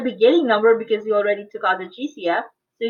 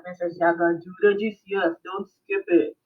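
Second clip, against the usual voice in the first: lower, quieter pretend diagram voice; talking diagram; 4 semitones lower, 4 dB quieter than usual. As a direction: play a diagram talking to the student, voice dropped low. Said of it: "Imagine the diagram talking to you like".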